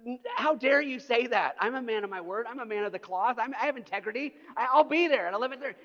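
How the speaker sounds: hammed-up furious protest; indignant outburst. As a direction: play the wounded man of the cloth. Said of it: "so I'm furiously texting him".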